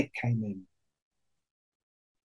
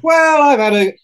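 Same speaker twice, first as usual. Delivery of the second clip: smug rock star; 12 semitones higher, 8 dB louder than usual. Retold or imitated. Voicing a rich rock star.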